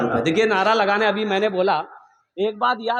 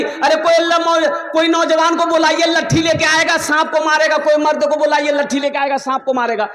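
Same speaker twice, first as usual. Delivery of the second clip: mock panicked women; 7 semitones higher than usual. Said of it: "तो औरतें चिल्लाने लगी".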